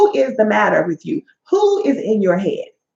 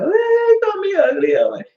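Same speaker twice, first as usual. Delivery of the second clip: much higher, whimpering mock whine; mock whiny crybaby; 9 semitones higher than usual. Mocking adults who still cry over being called ugly, high whiny whimper. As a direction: whine it like a hurt child.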